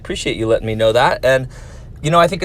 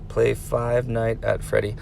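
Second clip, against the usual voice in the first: ominous hush; 3 semitones lower, 8 dB quieter, slower than usual. Retold or imitated. Teacher warning the viewer about a scary game, hushed. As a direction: Play Five Night at Freddy, said much softer and slower. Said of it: "I'm going to warn you, good luck".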